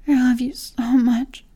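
usual speaking voice

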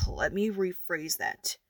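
ordinary talk, nothing marked

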